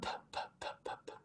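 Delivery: "P" in unvoiced puffs of air; breathy "P, P, P" with no voice; whispered unvoiced "P" bursts. Shown as a demonstration. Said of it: "The difference is this".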